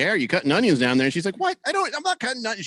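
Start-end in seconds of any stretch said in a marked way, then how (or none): 0.0-1.1 louder, fast call down the stairs; loud quick call to his wife downstairs. Retold or imitated. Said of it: "I asked her, like". 1.4-2.7 higher, fast, indignant imitation of his wife; husband imitating his irritated wife, higher and fast; mock annoyed wife. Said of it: "She started getting mad at me".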